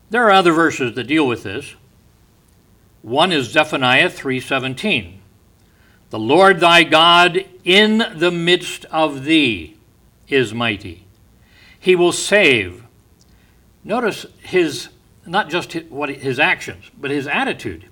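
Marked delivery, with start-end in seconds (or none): 6.1-12.8 slow, loud, higher proclaiming; preaching. Acted. Preacher proclaiming Zephaniah to the church, slow and loud. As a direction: proclaim the verse with power.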